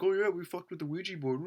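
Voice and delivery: high, mocking put-on voice; mocking girlfriend impression. Storyteller mimics the roommate's girlfriend admitting it, mocking high voice. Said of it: "her now girlfriend was like".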